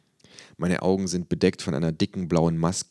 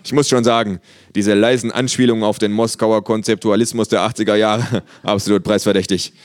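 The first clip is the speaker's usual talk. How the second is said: loud, amused praise; enthusiastic visitor; 4 semitones higher, 7 dB louder than usual. A narrator's reading. Art visitor praising kangaroo's work, loud enthusiasm. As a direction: gush loudly with a chuckle.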